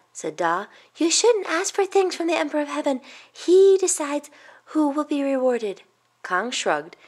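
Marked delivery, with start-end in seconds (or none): 1.0-5.8 put-on high-pitched, admonishing boy; earnest young boy. Youngest boy correcting his brother about the Emperor, high and earnest. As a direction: lecture like a small child.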